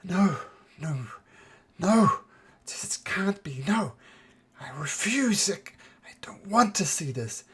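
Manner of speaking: rising, pleading no's sliding into a whisper; a woman refusing a vision; panicked babbling; frantic denial. Acted. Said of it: "and she's saying".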